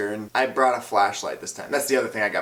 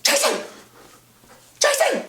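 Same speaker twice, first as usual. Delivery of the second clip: tiny squeaked yell, very high; strained, squeaky acted cry for help; restrained panicked yell; 4 dB louder than usual. Kid calling his friend without scaring the spider, squeaky yell. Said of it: "I'm trying to yell for my friend, but I don't wanna s-, freak out the spider".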